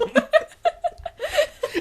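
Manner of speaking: very high squealing laughter. Real, not acted.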